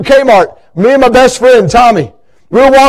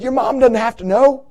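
hushed, sly little voice; tempting whisper; 8 dB quieter than usual. Retold or imitated. Young [name] coaxing Tommy at the checkout, hushed and sly. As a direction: coax him quietly to steal.

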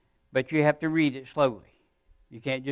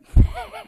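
short laugh; 7 dB louder than usual. Real, not acted. Stumbling over words, laughs.

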